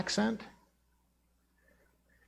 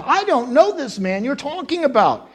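higher, louder emphatic denial; sworn denial; 6 semitones higher, 5 dB louder than usual. Acted. Preacher acts Peter swearing to the crowd, emphatic denial. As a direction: swear it with raised voice. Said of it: "he swore to them".

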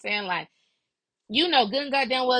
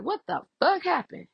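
high-pitched, incredulous mimicry; swooping high mock shock; shocked question; 10 semitones higher than usual. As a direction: imitate someone demanding to know what happened. Retelling second friend's shocked question.

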